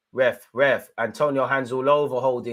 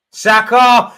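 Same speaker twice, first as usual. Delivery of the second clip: loud, high yell of the name; excited shout; 12 semitones higher, 14 dB louder, slower than usual. Real, not acted. Fan yelling Saka's name thinking he scored, loud and high. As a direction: leap up as if it's a goal.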